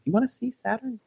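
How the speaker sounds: retold excited offer to a little kid; playful offer to child. Retelling offer to young daughter.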